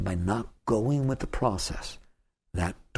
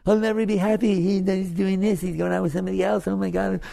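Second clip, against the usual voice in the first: much higher, louder, fretful wail; whiny complaining mind; 9 semitones higher, 3 dB louder than usual. Acted. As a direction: play the mind whining that nothing goes its way.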